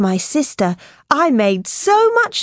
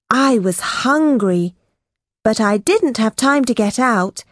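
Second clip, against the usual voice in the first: plain reading voice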